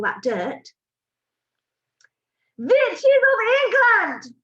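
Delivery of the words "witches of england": slow, high, loud summons; shouted witch call. A narrator's reading. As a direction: bellow it slowly across the hall. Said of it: "shouted the Grand High Witch".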